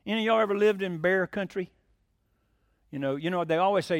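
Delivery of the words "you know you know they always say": normal talking voice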